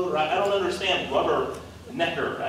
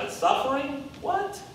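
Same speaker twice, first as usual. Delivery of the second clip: mock disbelief; 12 semitones higher than usual. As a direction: pitch jumps high on "suffering" and "What?". Mocking the crowd's disbelief.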